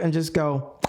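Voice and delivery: ordinary talk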